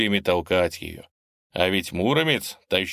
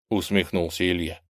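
ordinary delivery

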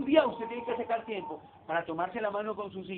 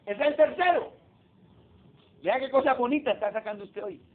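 usual speaking voice

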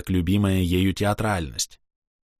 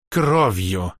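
heartfelt vow; 3 dB louder than usual. Acted. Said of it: "повторил Кастиэль, прижимая ладони к сердцу".

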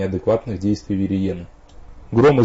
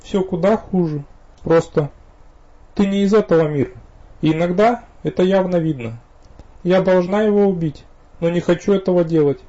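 higher female voice, troubled; young woman voice; 9 semitones higher, 4 dB louder than usual. A narrator's reading. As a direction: voice the torn vampire girl. Viriena's voice, troubled.

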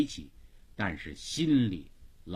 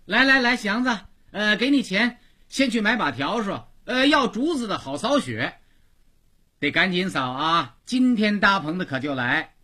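very high, loud, bustling summons; calling him out; 10 semitones higher, 7 dB louder than usual. Acted. Huniu calls Xiangzi out to buy a broom, loud urgent summons. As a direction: call out orders at the top of your range. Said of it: "虎妞往外叫他".

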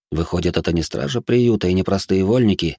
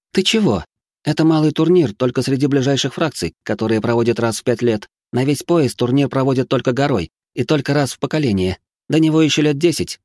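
put-on higher, brighter young woman; lively girl voice; 6 semitones higher than usual. A narrator's reading. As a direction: tease him like a cheeky girl. Reika laughing, teasing him.

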